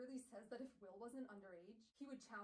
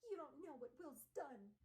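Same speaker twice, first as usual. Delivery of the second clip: high, slow put-on snooty voice; hammed-up wounded accusation; Gabriel Lightwood; 4 semitones higher than usual. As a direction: protest slowly as a haughty rival. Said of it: "He's like".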